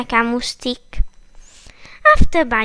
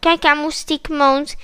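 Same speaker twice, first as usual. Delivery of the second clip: ordinary delivery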